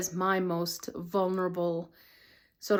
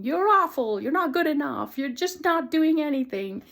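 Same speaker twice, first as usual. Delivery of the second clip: higher, louder mocking critic voice; voicing a cruel inner critic, raised and shrill-free but sharp; harsh inner critic; 7 semitones higher, 4 dB louder than usual. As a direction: sneer it like the nagging voice in your head. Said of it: "this voice that's saying".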